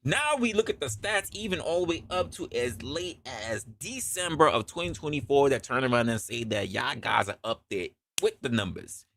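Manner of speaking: usual speaking voice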